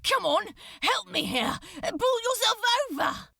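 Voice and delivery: straining cry. Acted. Crowley cries to Aziraphale to pull himself over, straining. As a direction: cry out with effort. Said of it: "he cried".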